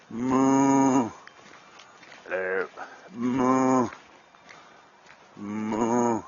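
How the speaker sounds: playful cow moo. Pretend. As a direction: call the cows with a moo. Calling the cows.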